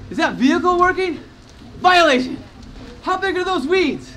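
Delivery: high, loud mock inspector; officious inspector. Neighborhood inspector cites a homeowner's yard, loudly accusatory. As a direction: call out each offence like an accusation.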